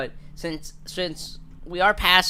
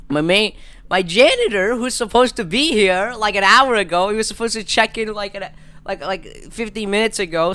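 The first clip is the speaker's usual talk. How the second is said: worked-up rant; 5 semitones higher, 4 dB louder than usual. Real, not acted. Mock outrage at absent janitor.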